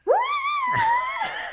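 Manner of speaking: a strained falsetto yelp, way up high; mock falsetto wail. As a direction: imitate a singer straining for the top note. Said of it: "this falsetto thing".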